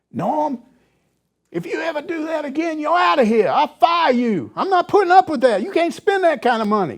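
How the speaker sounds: mock the athletic director firing a coach; Frank Broyles voice. Reporter impersonates the athletic director scolding coach, stern put-on voice. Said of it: "my Frank Broyles voice".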